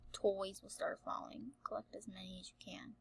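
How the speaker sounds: whispering. Real, not acted. Whispers game instructions.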